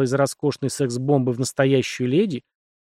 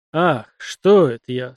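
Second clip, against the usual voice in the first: catching himself; 5 dB louder, slower than usual. A narrator's reading. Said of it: "спохватился".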